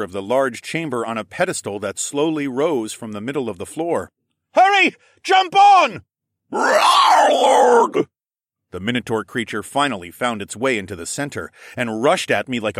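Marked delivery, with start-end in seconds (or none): 4.5-6.0 high, loud yell; full-force calling over noise; urgent shout. Acted. Urgent calls over roaring din. 6.5-8.1 long, loud bestial roar; drawn-out creature bellow; monster roar. Acted. Minotaur creature roars as it charges.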